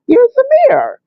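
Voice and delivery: high, strained and far-off; strange dazed voice. Retold or imitated. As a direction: sound dazed, like after a stroke. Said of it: "But I sounded different".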